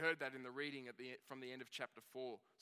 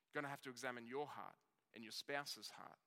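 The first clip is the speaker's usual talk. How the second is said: ordinary delivery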